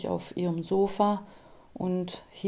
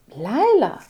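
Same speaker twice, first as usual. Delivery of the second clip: loud, high disbelieving echo; man startled to hear his friend's name; surprised echo; 5 semitones higher, 10 dB louder than usual. A narrator's reading. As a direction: repeat the name in shock.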